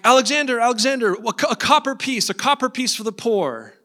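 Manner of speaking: high, urgent street beggar's call; acted beggar crying out from the crowd; pleading beggar. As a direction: call up to the king for alms. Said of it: "this beggar is saying".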